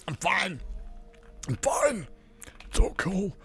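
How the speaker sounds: high, soft mock tearful voice; imitated choking up. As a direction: squeak it out as if holding back tears. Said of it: "I start choking up and I'm like".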